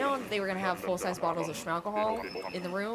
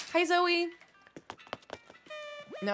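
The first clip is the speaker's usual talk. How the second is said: ordinary delivery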